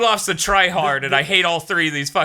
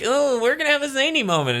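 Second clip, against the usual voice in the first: mocking sing-song; 9 semitones higher than usual. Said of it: "'Cause it's like".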